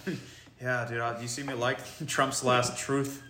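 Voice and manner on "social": mock Fox News dad; Trump fan impression. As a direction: play the proud Truth Social guy, hammed up. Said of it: "It's like".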